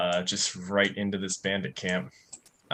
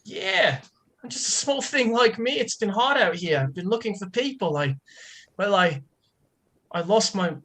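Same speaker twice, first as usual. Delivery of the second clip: much higher, small and pleading; pitiful lost wanderer; 9 semitones higher than usual. Acted. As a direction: play a tiny lost soul begging for sympathy. Player voices Raptor.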